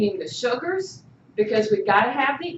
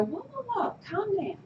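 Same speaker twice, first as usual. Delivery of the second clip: high, soft soothing voice; calm yourself down gently like a nervous system; high hushed whoa, calm down; soothing parasympathetic; 6 semitones higher, 10 dB quieter, faster than usual. Pretend. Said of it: "parasympathetic in the background trying to go".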